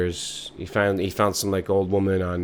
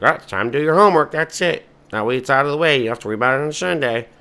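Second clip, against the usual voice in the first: higher, louder, fast; nagging parent; 6 semitones higher, 5 dB louder, faster than usual. Acted. Parents telling kids in garage to do homework, fast. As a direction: rush through the homework order.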